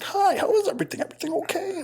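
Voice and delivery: high sugary customer-service voice, way up in pitch; squeaky mock-polite greeting; mock fake-sweet manager. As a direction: parody an overly sweet manager checking on the table.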